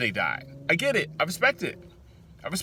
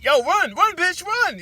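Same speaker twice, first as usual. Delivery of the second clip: much higher and louder frantic shout; screaming at the TV; 7 dB louder, slower than usual. Retold or imitated. Viewer seeing people line up, screaming at the TV. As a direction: scream a warning through the screen. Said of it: "I'm screaming at the T V".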